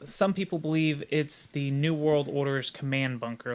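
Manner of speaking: usual speaking voice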